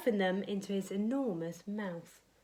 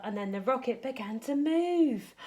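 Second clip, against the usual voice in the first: usual speaking voice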